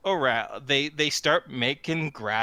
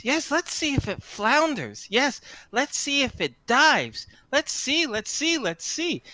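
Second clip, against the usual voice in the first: high, playful scheming fairy; fairy voices; 9 semitones higher than usual. Acted. Fairies toying with the stolen guitar, high and playful. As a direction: muse mischievously like a prankish sprite. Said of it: "and they say".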